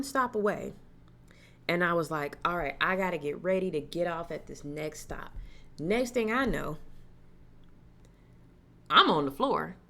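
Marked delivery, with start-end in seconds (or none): none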